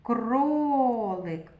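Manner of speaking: Кролик with a very long held о; drawn-out О. Shown as a demonstration. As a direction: stretch the о in Кролик to single it out. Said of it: "Звук О голосний".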